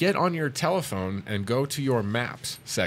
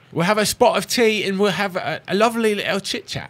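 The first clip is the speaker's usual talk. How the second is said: higher, lilting mock-English accent; hammed-up English tea-time accent; playful English accent; 9 semitones higher, 6 dB louder than usual. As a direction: put on a jolly English accent for fun. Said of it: "an English accent 'cause it's just bloody fun".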